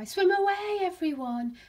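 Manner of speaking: high, loud warning call; great cry. A narrator's reading. Someone warning all the fish, great cry. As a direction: cry out high, easing down at the end. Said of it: "he heard a great cry".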